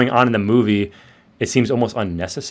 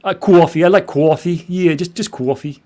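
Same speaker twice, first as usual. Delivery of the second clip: higher put-on Brooklyn accent; 6 semitones higher than usual. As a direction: play up the Brooklyn vowels on coffee. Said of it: "he's doing a Brooklyn accent there".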